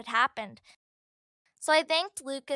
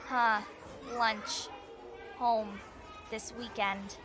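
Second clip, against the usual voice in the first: lightly voiced slow-witted boy, a little lower; dull echoing boy; 3 semitones lower, 5 dB quieter, much slower than usual. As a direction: parrot the words back, one at a time, with gaps.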